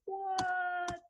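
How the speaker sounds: sudden high startled what; surprised. Real, not acted. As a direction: yelp in surprise at the bug. Bug appears on screen.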